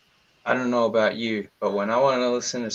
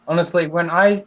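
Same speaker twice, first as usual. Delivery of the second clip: ordinary talk, nothing marked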